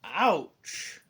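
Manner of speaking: lightly acted creature yelp; low-voiced yelp. A narrator's reading. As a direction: yelp briefly in a put-on low voice. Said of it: "a low voice yelps".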